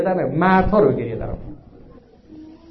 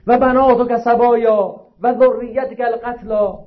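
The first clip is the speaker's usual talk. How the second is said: high, slow declaimed cry; imitated outcry, pitched up and drawn out; retold crying out; 6 semitones higher, slower than usual. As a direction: stretch it out like a public lament. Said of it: "فریاد زد".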